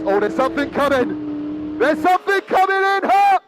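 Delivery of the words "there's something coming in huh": higher, louder, eager call; rising excitement. Real, not acted. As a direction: voice lifts as the car nears.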